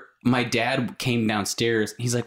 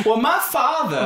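hinted Southern belle; 12 semitones higher, 3 dB louder than usual. Pretend.

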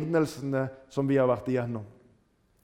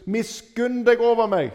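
higher, louder plea for mercy; pleading cry; 6 semitones higher, 5 dB louder than usual. Retold or imitated. Blind man crying after Jesus, loud pleading. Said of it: "hører du den rope".

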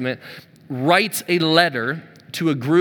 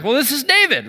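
excited; 7 semitones higher than usual. Retold or imitated. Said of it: "so I got excited".

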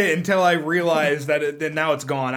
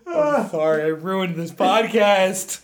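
laughing apology; 6 semitones higher, 6 dB louder than usual. Real, not acted.

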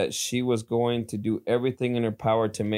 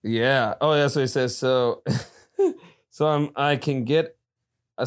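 usual speaking voice

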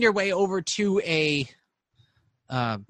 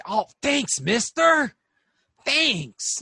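high, eager thank-you voice; high grateful character voice; 8 semitones higher, 3 dB louder, much slower than usual. Acted. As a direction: thank him brightly, like a kid.

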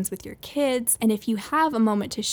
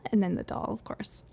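ordinary delivery

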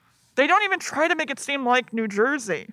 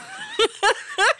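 high shrieking laugh. Real, not acted. Host cracking up at the film's absurd gag, squealing laugh.

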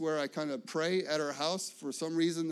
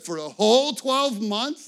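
higher and louder disbelieving question; tax preparer's disbelief; 5 semitones higher, 10 dB louder than usual. Retold or imitated. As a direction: ask it like you can't believe it. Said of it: "That's how she said it".

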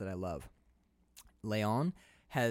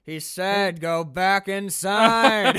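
louder, high, very slow menacing order; imitating the villain; 6 semitones higher, 10 dB louder, much slower than usual. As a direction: draw out the order lazily and menacing. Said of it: "he goes".

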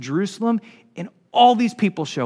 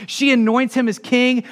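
ordinary delivery

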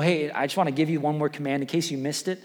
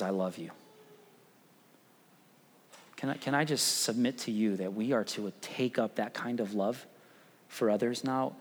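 tender; 5 dB quieter than usual. Acted. Tender turn to the cross.

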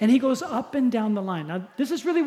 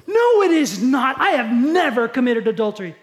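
indignant denial; 4 semitones higher, 8 dB louder than usual. Acted. Andy denying the charge to Jesus, loud and indignant. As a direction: insist you never did it.

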